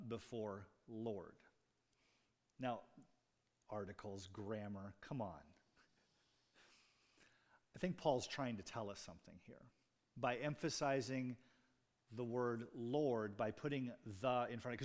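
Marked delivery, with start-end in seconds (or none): none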